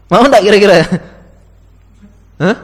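high, loud, playful challenge to the room; loud teasing challenge. Real, not acted. Preacher challenges listeners about sending their baby away, loud and teasing.

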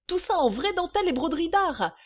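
high, loud, indignant yelping; yelping shrew. A narrator's reading. Creditor defending her lingerie bill, yelping. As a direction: press the bill loudly at a high pitch. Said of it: "glapit la mégère".

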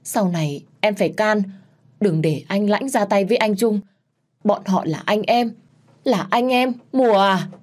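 last breathless words before dying; choked voice. Acted. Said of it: "mợ nghẹn ngào nói với tôi". (giọng nghẹn ngào yếu dần)

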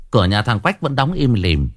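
ordinary delivery

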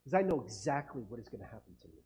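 low, soft, serene acceptance; softly resigned to death, unafraid; calm unafraid. Acted. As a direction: say it with quiet peace, no fear. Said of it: "But they're like".

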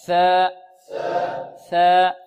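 tongue tip on upper teeth for ثاء, long vowel; مخرج الثاء. Shown as a demonstration. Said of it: "انطقوا معي". (ثاء من طرف اللسان للتلقين)

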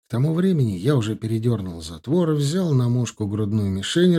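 ordinary delivery

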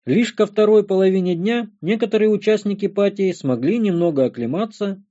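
usual speaking voice

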